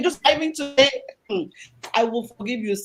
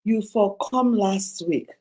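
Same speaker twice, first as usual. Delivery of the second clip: Pidgin plea; 6 semitones lower, 3 dB louder, slower than usual. Retold or imitated. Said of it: "who says".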